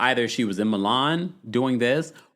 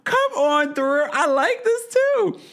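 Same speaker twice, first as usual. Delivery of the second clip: very high, slow, stretched-out gushing; over-the-top high-pitched delight; delighted approval; 4 dB louder, slower than usual. Real, not acted. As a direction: squeal your approval, dragging out the words. Gushing over a viewer's tagline.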